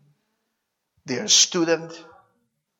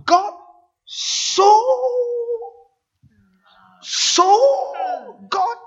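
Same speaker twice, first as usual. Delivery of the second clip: rising cry of "so", higher and louder each time, then a slow "loved the world"; full-force preaching; 4 dB louder, much slower than usual. Acted. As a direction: build the congregation to a climax, one "so" at a time. Building preaching climax.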